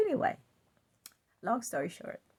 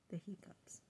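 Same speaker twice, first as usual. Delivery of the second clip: whispered; 2 semitones lower, 16 dB quieter than usual. Real, not acted. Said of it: "repeated softly".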